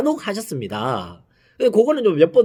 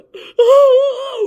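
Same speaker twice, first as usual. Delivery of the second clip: put-on high crying wail of a small child; child's sob; 7 dB louder than usual. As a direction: let out a child's sobbing cry. (아이처럼 우는 소리)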